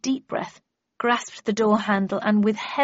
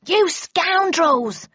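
high, slow, louder raging yell; furious shout; 9 semitones higher, 6 dB louder, much slower than usual. A narrator's reading. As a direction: bellow it in fury. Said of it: "shouted a second voice".

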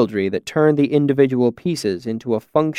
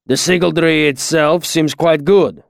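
put-on slow accented master, higher-pitched; Kilvin, slow; 4 semitones higher than usual. A narrator's reading. Master assessing student's sigildry, slow and deliberate. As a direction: weigh each word like a craftsman passing judgment. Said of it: "Kilvin said slowly".

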